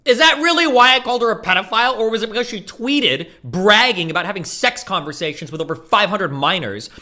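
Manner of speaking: heated and incredulous; indignant retort. Real, not acted. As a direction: fire back at the accusation, pitched high.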